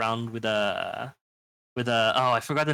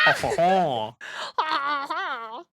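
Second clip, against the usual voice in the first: mock French laugh; 12 semitones higher, much slower than usual. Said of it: "I'll say, like".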